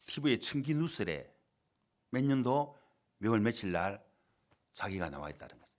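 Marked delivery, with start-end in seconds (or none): none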